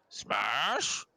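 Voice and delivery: puzzled ogre, rising to a question; stretched SMASH swooping up high. Acted. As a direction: ask it like a confused, hopeful ogre. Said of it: "the ogre goes".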